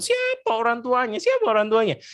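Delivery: high exasperated who-are-the-parents; annoyed young man. Acted. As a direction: look around irritated for the parents.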